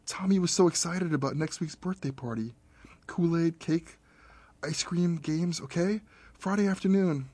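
brightly manic grieving mother; manic excitement. Acted. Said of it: "vocalizing scattershot thoughts".

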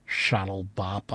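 low muttered word. A narrator's reading. Narrator muttering the odd word to himself. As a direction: mutter it to yourself. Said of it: "I muttered".

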